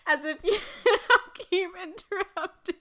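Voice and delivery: high, loud laughing plea; laughing protest. Real, not acted.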